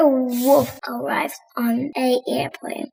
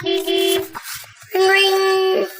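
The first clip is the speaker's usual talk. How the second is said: mimicked phone ring, rising higher; 6 semitones higher than usual. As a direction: ring like a telephone, climbing in pitch. Phone ringing sound.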